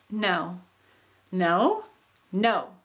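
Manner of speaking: each no with a different meaning; three different no's. Shown as a demonstration. Teacher shows how one word carries different meanings. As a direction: say no three ways, changing the tune each time. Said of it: "those mean very different things".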